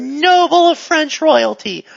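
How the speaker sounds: normal talking voice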